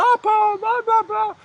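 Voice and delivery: very high, loud mock-panic babble; hammed-up hysterical squeal; mock freak-out. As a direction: imitate someone losing it completely. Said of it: "just freaking out".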